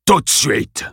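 slow, imperious order; commanding principal. Acted. Principal orders the children, slow and imperious. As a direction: crack it like a whip.

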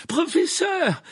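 very high, loud shout; frantic, relieved-to-find-you yell; cried out. A narrator's reading. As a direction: call out urgently for help. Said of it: "s'écria Harry".

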